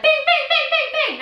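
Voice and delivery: high playful bings; chirpy electronic beeps; video game chime. Retold or imitated. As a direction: mimic a game's ding-ding sounds. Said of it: "video games are always like".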